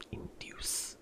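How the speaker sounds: breathy whisper of the word. Pretend. Repeating word in varied voices.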